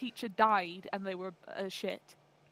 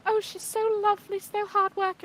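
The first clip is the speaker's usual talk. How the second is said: high, gushing teacher praise; sweet teacher voice; 8 semitones higher than usual. Retold or imitated. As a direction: gush like a fond teacher. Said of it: "they were like".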